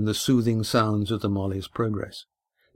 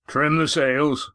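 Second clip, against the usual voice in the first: slow, higher-pitched command; raised, deliberate sailing command; skipper's order; 4 semitones higher, slower than usual. A narrator's reading. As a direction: order the crew, drawing words out. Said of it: "ordered Roaring Jack".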